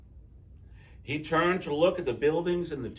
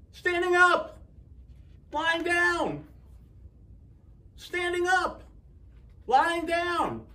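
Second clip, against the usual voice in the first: high, loud yelling back and forth; 4 dB louder, slower than usual. A narrator's reading. Townspeople yelling their slogans at each other. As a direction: shout each slogan over the other side.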